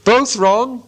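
high, triumphant call; relieved cry. A narrator's reading. Bilbo, very much relieved, cries out in triumph. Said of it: "cried Bilbo".

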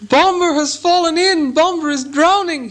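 high, drawn-out cry of alarm; panicked, high-pitched wailing cry for help; dreadful wail. A narrator's reading. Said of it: "a dreadful wail from Bilbo".

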